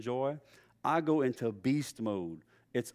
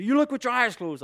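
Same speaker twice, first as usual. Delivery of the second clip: higher, louder teasing scold; mock exasperated dad; 9 semitones higher, 5 dB louder than usual. Father teasing his daughter with the stepdad's line, mock scold. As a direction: echo the old line at your kid.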